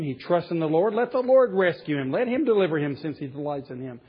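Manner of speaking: loud, scornful jeering; sneering mob jeer; mocking crowd. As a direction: throw the taunt up at him. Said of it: "quoting those who mock him".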